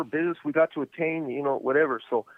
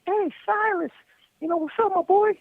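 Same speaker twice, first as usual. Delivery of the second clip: high, easygoing hey-what's-up call; casual buddy greeting. Retold or imitated. Friendly call to Silas across the tier, high and casual. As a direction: toss it off like greeting a friend on the street. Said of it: "there's no, like".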